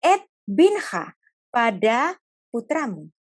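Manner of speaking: slow, careful, gentle words; God speaking slowly and gently; slowed-down speech. Acted. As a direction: ease each word out slowly. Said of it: "Jadi ngomongnya itu dipelan-pelanin gitu".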